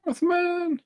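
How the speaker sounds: sudden high excited yell; excited shout. Real, not acted. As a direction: blurt it out with delight. Excited recognition of a picture.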